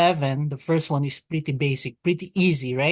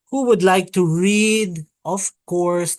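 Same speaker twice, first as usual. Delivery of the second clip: ordinary talk, nothing marked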